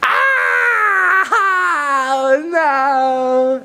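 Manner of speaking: high, loud drawn-out wail of "no"; hammed-up shriek of panic; Israelites' cowardly scream. Storyteller acting the Israelites fleeing the giant, high panicked scream. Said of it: "this was the Israelites' reply".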